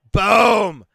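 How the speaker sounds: sudden high shouted boom; boom call. Real, not acted. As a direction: shout it as the punch lands. Knockout punch lands.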